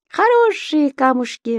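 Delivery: high excited little creature voice; Субастик восклицает. A narrator's reading. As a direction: exclaim it gleefully in a high voice. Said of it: "воскликнул тот". (высокий восторженный голос Субастика)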